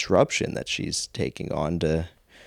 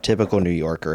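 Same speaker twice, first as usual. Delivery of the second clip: ordinary talk, nothing marked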